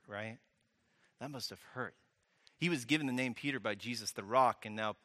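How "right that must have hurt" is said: hushed repeat. Real, not acted.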